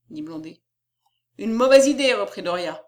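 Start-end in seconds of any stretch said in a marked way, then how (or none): 1.4-2.1 loud, higher rebuttal; emphatic publisher's rejection; curt dismissal. Acted. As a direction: shoot the suggestion down. Publisher brusquely rejects a suggestion.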